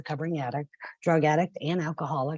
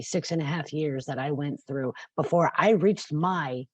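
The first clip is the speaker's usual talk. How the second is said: normal talking voice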